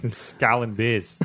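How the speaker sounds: put-on slow, drawn-out Aussie accent; Australian accent. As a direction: say it like an Aussie bloke. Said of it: "Pretty good Aussie accent".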